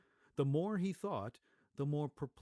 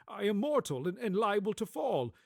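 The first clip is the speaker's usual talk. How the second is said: put-on higher, louder, pleading old man; fearful old man; 8 semitones higher, 8 dB louder than usual. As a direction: beg the spirit nervously. Scrooge pleading.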